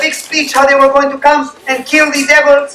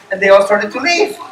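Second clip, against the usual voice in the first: ordinary delivery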